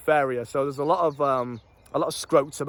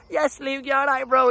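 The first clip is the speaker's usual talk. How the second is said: a higher, kid-like hail, lightly voiced; kids greeting; 9 semitones higher than usual. Little kids on bikes call out to Luke, higher mock kid voice. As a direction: call out like a little kid riding past on a bike. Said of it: "the little kids that know me".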